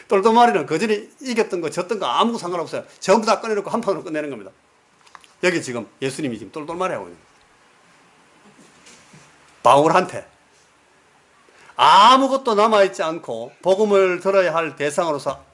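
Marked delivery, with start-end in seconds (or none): none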